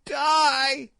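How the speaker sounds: high, loud shriek; terrified furious shriek; shriek of terror and fury. A narrator's reading. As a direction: scream it like a cornered old witch. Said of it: "she shrieked in terror and fury".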